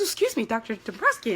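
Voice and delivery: softly composed apology; mother trying to compose herself before the doctor, quiet. Retold or imitated. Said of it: "I tried to compose myself".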